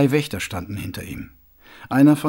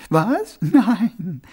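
lightly voiced woman, pitched higher; Rosi, dismissive young woman; 7 semitones higher, 5 dB louder than usual. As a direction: deny it, then explain in a higher voice. Rosi explains to Mumm.

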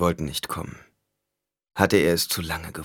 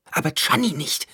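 urgent; 10 semitones higher than usual. A narrator's reading. Alia presses urgently.

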